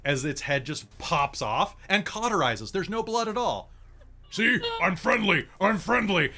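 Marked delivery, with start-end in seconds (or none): 4.3-6.3 loud, high creature voice; red cap creature voice. Acted. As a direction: shout it in a higher monster voice. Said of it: "The red cap's gonna".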